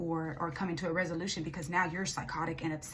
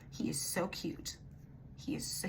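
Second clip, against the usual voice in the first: tender coo; 7 dB quieter than usual. Real, not acted. Tarot reader cooing fondly over the client's little son, soft and slow. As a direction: melt a little as you say it.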